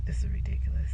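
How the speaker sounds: whispered complaint; whispered frustration; exasperated whisper. Real, not acted. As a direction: breathe it out, fed up. Fed up waiting in the parking lot.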